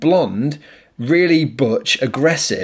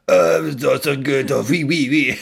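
a British actor faking a French policeman; shady French accent. Retold or imitated. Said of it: "a really shady French accent".

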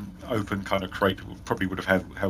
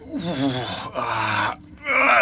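orc groan; much slower than usual. Acted. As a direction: heave himself up with a groan. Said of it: "hands on his knees going".

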